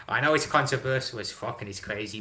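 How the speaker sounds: ordinary talk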